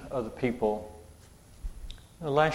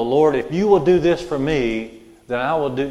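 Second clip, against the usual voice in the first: mock bargaining prayer; 3 semitones higher, 7 dB louder than usual. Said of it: "I'm not talking about saying".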